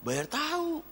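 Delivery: usual speaking voice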